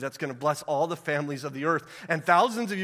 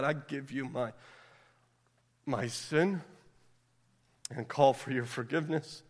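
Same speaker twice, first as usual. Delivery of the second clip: much softer, lower prayer voice; 7 semitones lower, 9 dB quieter than usual. Acted. Pastor leading the congregation in prayer.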